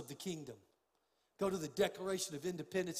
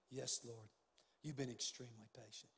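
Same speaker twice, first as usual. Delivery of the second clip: humble quiet reply; 7 semitones lower, 11 dB quieter than usual. Retold or imitated. Answering God in retold prayer.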